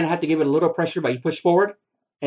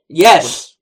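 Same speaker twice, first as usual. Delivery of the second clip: triumphant shout of success; excited; 11 dB louder than usual. Real, not acted. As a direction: cheer it out loud. Narrator finally starts after struggle.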